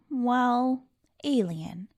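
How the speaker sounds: plain reading voice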